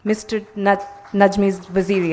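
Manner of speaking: ordinary talk, nothing marked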